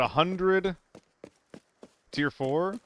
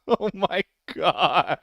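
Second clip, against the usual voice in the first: laughing; 7 semitones higher, much slower than usual. Real, not acted. Laughing in disbelief.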